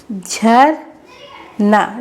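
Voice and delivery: stretched even longer; slow sounding out. Shown as a demonstration. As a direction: sound it out syllable by syllable. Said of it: "तो".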